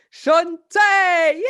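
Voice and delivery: high, loud shout; cheering introduction. Real, not acted. Host welcoming friend and artist, cheering shout. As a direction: whoop the name like a crowd cheer.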